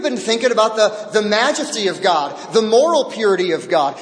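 full-voiced sermon climax; fervent preaching. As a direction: preach it up with growing fire.

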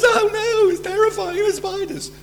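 very high-pitched squeal of pretend fright; mock spider phobia. Preacher imitating someone afraid of spiders, mock terror. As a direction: squeak it like a silly phobic panic. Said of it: "like someone's afraid, uh, afraid of spiders".

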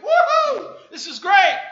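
laughing, shouted high whoop; gleeful whoop. Retold or imitated. New hire celebrating the job offer, laughing whoop. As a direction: celebrate like you've won.

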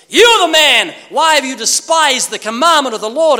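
high, loud accusing shout; stern rebuke. Acted. Nathan confronting David, loud high rebuke. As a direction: point the finger and thunder.

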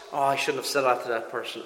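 mock guilty mutter. Voicing a guilty inner thought.

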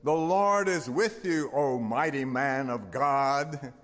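a hint of a booming angel; angel proclaiming. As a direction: announce it like a heavenly messenger. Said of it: "The angel of the Lord appears to him and says".

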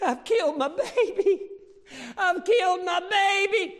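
high, loud wailing cry of grief; anguished cry. Retold or imitated. Preacher voicing a girl's anguish in the clinic, high and loud. Quoting girl crying in clinic.

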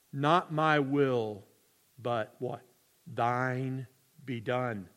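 soft, slow, reverent prayer; hushed prayer. Retold or imitated. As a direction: pray it quietly, surrendering.

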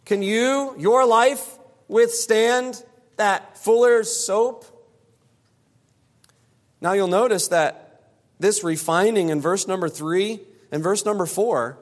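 ordinary talk, nothing marked

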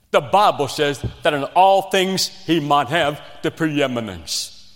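normal talking voice

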